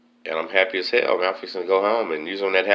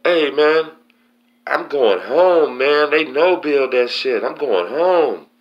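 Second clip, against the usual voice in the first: high, loud, drawn-out excited yell; 10 semitones higher, 7 dB louder, slower than usual. Retold or imitated. Announcing to the jail tank he's going home, loud excited yell. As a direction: shout the news to the whole tank, dragging out home. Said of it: "I just yell out".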